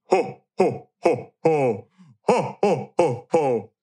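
Santa laugh. Retold or imitated. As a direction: boom out Santa's jolly laugh. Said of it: "I hear Santa downstairs".

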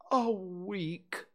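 high, breathy dismay; thin, whispery gloom; gloomy bear. A narrator's reading. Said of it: "Pooh gloomily".

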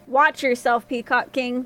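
plain reading voice

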